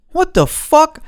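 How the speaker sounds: high-pitched, loud what the fuck in disbelief; incredulous. Real, not acted. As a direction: shriek it like you can't believe it. Outraged at the neighbours' cluelessness.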